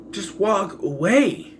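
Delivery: slow, high, drawn-out exasperation; exasperated. Acted. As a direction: stretch it out as if spelling out the obvious. Exasperated advice to film character.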